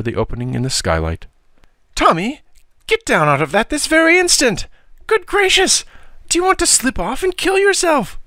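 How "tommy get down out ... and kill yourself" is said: high, loud, frantic scolding; fretful aunt ordering him down at once; alarmed aunt. Acted. Aunt scolding boy on roof.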